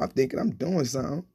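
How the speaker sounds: plain reading voice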